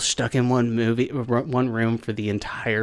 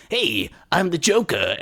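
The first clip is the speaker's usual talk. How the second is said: Joker; 6 semitones higher, slower than usual. Retold or imitated. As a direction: do an actor's Joker voice demo. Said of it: "so I did this voice, and it was".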